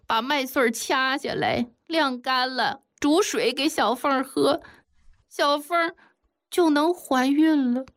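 high, whining wife making excuses; fake tearful. A narrator's reading. Sun San's wife explaining herself, mock tearful. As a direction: whimper the confession with put-on tears. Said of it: "擦了一把根本就没流出来的眼泪".

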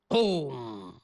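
one low imitated drum strike; drum boom. Shown as a demonstration. Teller imitating a single huge drum stroke, low boom. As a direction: thump it out like a great drum. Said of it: "比我学的声音".

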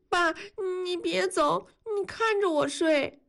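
a frightened daughter asking her father; young girl voice. Acted. Narrator voices daughter Yanni. (提高音调的小女孩声音，带点惊慌)

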